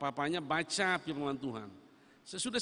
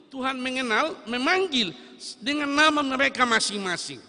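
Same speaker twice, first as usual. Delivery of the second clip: higher and louder, rising to a peak; impassioned pulpit cry; fervent preaching; 8 semitones higher, 10 dB louder than usual. Acted. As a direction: build it up word by word.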